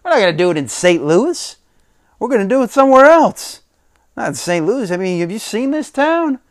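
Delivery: high, fast, scoffing promo voice; mock incredulous Rock. Retelling The Rock's promo, high fast scoff. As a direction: ham up the heel taunting the town. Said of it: "He's like".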